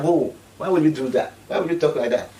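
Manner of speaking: loud, high, indignant whoa; shocked reaction. Retold or imitated. Vlogger reenacting his reaction to the speech, indignant. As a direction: demand why she said it. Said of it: "I was like".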